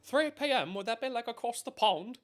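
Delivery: high, loud, bad British accent; terrible British accent. Streamer teasing a viewer across the pond, terrible British accent. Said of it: "Got my terrible accent".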